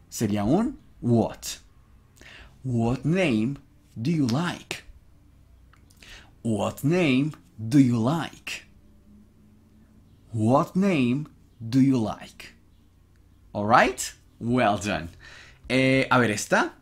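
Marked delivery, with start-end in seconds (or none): 2.6-12.6 slow, evenly spaced English for learners; slow model reading. Shown as a demonstration. Teacher modelling a question for students, slow and careful. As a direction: leave a gap after "name", drawing each word out.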